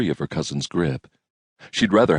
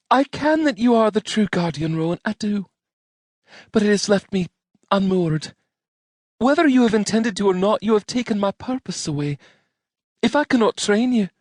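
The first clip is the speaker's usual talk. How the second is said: put-on troubled young woman voice. Jeanette lamenting lost purpose.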